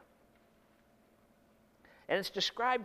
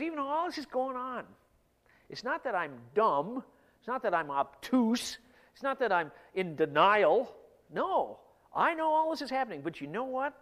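insistent, higher and punched; emphatic protest; 6 semitones higher, 5 dB louder than usual. Acted. Preacher as David answering doubters, emphatic protest. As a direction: protest each point firmly, voice lifting.

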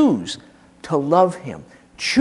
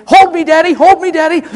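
put-on high, loud little child begging to be held; pleading child; 9 semitones higher, 7 dB louder than usual. Preacher imitates a frightened child calling to daddy, high and pleading. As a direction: cry out like a small child clinging to a parent's leg.

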